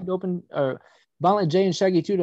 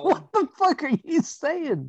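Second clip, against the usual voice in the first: squeaky high disbelief; 12 semitones higher, slower than usual. Real, not acted. Reacts to garbled speech.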